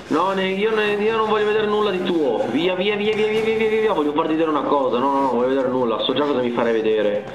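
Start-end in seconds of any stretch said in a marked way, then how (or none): none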